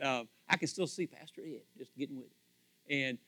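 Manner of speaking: normal talking voice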